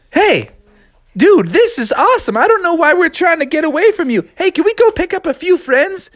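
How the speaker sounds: high, delighted frog; thrilled to join; high excited voice. Acted.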